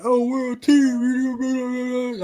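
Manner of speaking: high, very slow drawn-out whine; high slow mimic of a whiny teammate; mocking whiny teammate. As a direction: drag it out like a sulking gamer. Mocking whining teammate in game.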